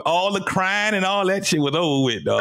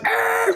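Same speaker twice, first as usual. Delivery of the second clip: startled yell. Retold or imitated. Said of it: "he was like".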